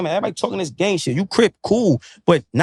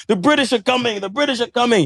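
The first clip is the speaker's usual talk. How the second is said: lightly hammed-up town crier warning, pitched higher; raised-pitch imitation of a herald's alert; mock Paul Revere alarm; 6 semitones higher than usual. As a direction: put on a mock warning cry, without really shouting.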